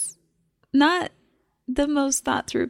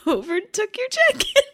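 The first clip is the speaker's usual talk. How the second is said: crying, worn out; 8 semitones higher, 3 dB louder than usual. Real, not acted. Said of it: "I can't stop crying now".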